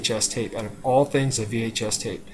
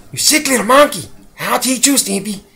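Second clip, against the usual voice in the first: much higher, louder, aggressive chihuahua screech; Ren impression; 10 semitones higher, 7 dB louder than usual. Ren yelling at Stimpy, high shrieking voice. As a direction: snarl it like an angry little dog. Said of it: "Ren would always be like".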